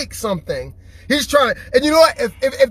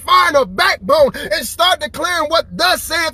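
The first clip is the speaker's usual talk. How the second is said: preaching; 6 semitones higher than usual. Acted. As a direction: preach it hard to the crowd. Preaching at full force.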